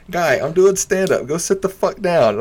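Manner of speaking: high-pitched, insistent scolding; exasperated put-down. Retold or imitated. Comic retelling order to heckler, exasperated. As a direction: replay it as an indignant dismissal.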